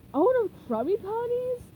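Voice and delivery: shocked Patrick; surprised. Acted. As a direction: gasp it out.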